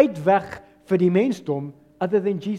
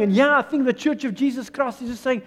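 ordinary talk

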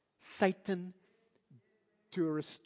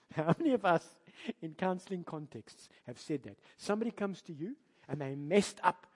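ordinary delivery

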